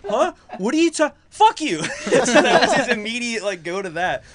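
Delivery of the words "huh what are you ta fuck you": high, loud baffled snap; confused, then hostile. Retold or imitated. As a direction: imitate groggy bafflement turning to cursing. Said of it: "he was so confused and just didn't like my tone".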